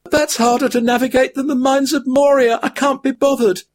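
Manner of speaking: high weary hobbit voice; a high-pitched hobbit worn out by his burden; weary Frodo. A narrator's reading. Frodo's held figure voice.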